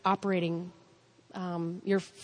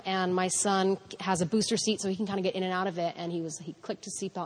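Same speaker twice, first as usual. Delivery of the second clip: ordinary talk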